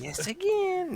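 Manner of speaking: very high-pitched witch voice; witchy voice. Retold or imitated. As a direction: screech it like a little witch. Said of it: "very, like, a high-pitched, like, witchy noise".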